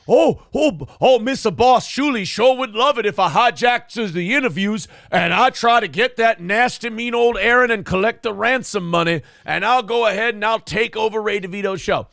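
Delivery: high, loud mocking caricature; Producer Joe. Host mocking the producer's scheme, hammed-up eager lackey voice. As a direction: ham it up as an over-eager underling. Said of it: "Producer Joe decided".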